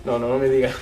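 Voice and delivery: chuckling through a teasing no-no; laughing while teasing about age. Real, not acted. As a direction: laugh it off playfully. Joking about her age.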